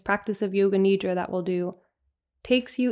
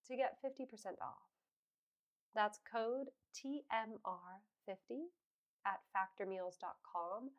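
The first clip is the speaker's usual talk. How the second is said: much softer podcast ad read; hushed, measured promo with the code spelled out; sponsor ad read; 19 dB quieter than usual. Real, not acted. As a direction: read the sponsor copy quietly, phrase by phrase. Reads second sponsor ad.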